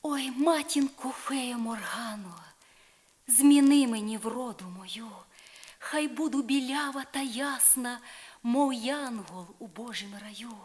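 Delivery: put-on young woman imploring her godmother; pleading Isolde. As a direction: beg the fairy for beauty with rising hope. Isolde begs fairy godmother.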